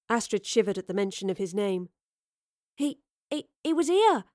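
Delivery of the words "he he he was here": stammering. A narrator's reading. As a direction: stammer it out, rising in shock. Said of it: "she stammered".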